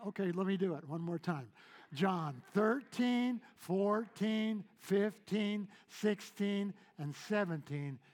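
loud, high preaching cadence, each number hammered; preaching at full force. Acted. Preacher counting verses to the congregation, full-force preaching. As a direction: thunder the verses out to the church.

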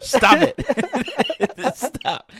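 high, loud, laughing plea to stop; exasperated shout. Real, not acted. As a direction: beg them to quit, half laughing. Soundboard spam, begging friends stop.

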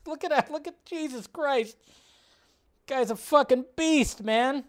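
high, laughing disbelief; awed amazement. Real, not acted. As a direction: gasp it out in amazement at his size. Awed laughing at Rock's height.

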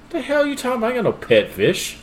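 high, incredulous protest; mock indignant denial. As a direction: play the fisherman acting baffled. Fisherman feigns ignorance in retold joke.

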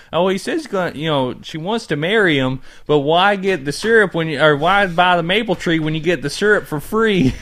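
high-pitched put-on Canadian accent; Canadian accent, playing it up. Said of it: "We're doing Canadian accents at work".

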